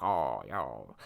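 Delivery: low, soft Jeff Goldblum drawl; Jeff Goldblum impression. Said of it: "I went to my Jeff Goldblum then".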